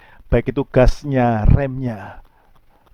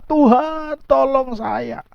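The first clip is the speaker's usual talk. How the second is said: high, acted plea for help; mock cry for rescue; crying out to God; 11 semitones higher, much slower than usual. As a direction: call out desperately on a raised pitch, without real shouting. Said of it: "Saudara akan teriak-teriak".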